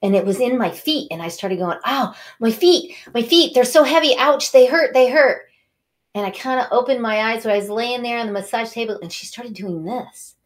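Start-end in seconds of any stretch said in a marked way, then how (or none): 1.9-5.5 high, loud mock cries of pain; louder and higher, acted yelps of hurt; re-enacted pained outcry. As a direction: complain loudly, acting out sore feet. Retold cries of pain in feet.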